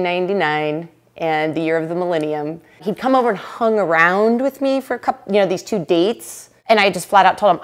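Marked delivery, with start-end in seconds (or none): none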